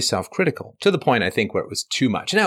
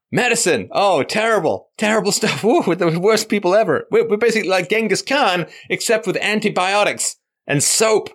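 higher, laughing mock despair; sarcastic self-pity; 7 semitones higher than usual. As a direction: lay on fake guilt. Mock self-hatred quote.

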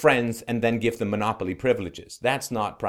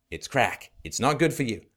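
ordinary talk

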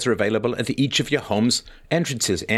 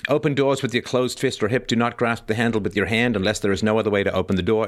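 ordinary talk, nothing marked

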